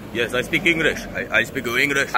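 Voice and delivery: badly faked Senegalese accent; unsure stab at a Senegalese accent; attempted Senegalese accent. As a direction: imitate a Senegalese man's accented English. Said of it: "I don't know how to do a Senegalian accent".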